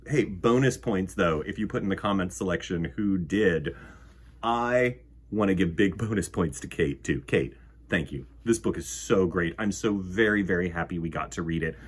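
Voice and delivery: plain reading voice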